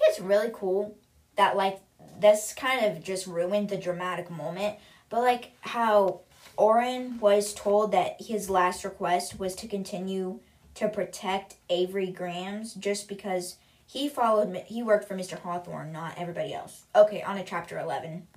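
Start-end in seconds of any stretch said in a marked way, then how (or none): none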